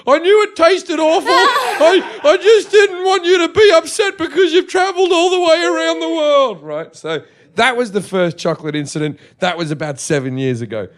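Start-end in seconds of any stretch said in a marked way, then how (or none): 0.1-6.6 much higher, louder, laughing-crying wail; hammed-up blubbering mother; mock tearful mum. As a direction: mum confessing to her son, mock sobbing. Mum's tearful confession, voiced.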